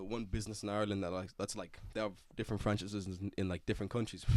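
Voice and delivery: soft, low, trailing mumble; mumbled slip. Real, not acted. Friend explaining a family's franchises, soft mumbled delivery. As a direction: mumble it quietly, losing grip on the words. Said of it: "Why am I speaking really?".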